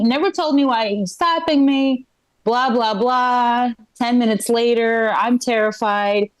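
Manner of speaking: ordinary talk, nothing marked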